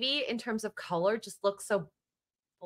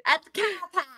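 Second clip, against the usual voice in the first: higher-pitched mock Boston accent; 7 semitones higher, much faster than usual.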